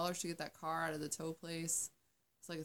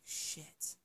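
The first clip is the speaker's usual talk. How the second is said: breathy whispered swear under the breath; whispered curse; 9 dB quieter than usual. Retold or imitated. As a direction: mutter it in dismay. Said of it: "I was like".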